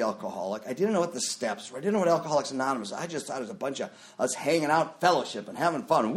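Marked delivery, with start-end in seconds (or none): none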